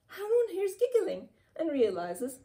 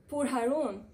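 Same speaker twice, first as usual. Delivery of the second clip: usual speaking voice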